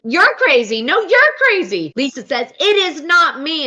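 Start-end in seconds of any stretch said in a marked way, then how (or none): 0.0-1.9 high, loud mock squabble; whiny-loud teen comeback, pitched way up; bickering teenagers. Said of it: "sounded like a bunch of teenagers". 2.6-3.7 high, loud, drawn-out denial; raised-voice mock protest; mock scream. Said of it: "Lisa screams again".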